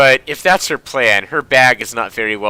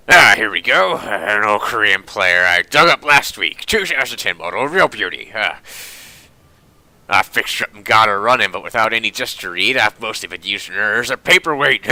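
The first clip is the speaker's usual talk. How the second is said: put-on old German tinkerer showing off his wares; proud old junk dealer with a hammed-up accent; gruff well-meaning German. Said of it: "He speaks with a gruff but well-meaning tone".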